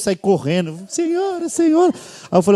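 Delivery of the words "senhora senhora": high-pitched anxious call. Retold or imitated. Son calling nervously to his mother, high calls.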